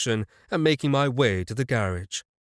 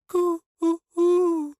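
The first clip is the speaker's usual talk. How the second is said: very high pigeon coo; mocking bird coo. A narrator's reading.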